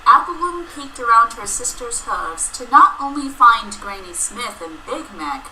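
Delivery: ordinary delivery, nothing marked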